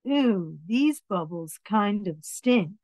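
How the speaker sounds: ordinary delivery, nothing marked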